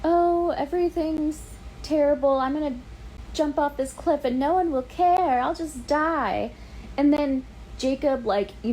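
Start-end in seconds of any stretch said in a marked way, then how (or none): 0.0-6.5 hammed-up tragic teen; mock melodramatic Bella. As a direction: parody her wallowing martyrdom. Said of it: "She's like".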